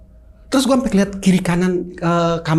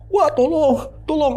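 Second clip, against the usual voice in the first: higher, imitating a scared cry for help; mock frightened plea; 10 semitones higher, slower than usual. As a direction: play the terrified brother begging. Frightened brother under blanket.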